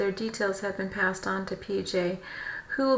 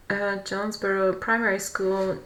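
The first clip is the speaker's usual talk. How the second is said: ordinary talk, nothing marked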